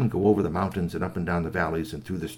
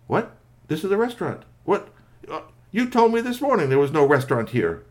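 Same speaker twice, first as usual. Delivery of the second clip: high, louder protest; incredulous, raised-pitch outburst; indignant disbelief; 8 semitones higher, 6 dB louder than usual. Acted. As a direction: challenge someone who misled you. GP discovers he was misled.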